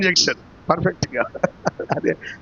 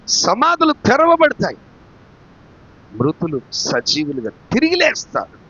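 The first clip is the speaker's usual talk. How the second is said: raised, emphatic preacher's voice; forceful preaching; 7 semitones higher, 5 dB louder, slower than usual. Real, not acted. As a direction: proclaim it with rising force, stretching key words. (గట్టిగా ప్రకటించే ప్రసంగం)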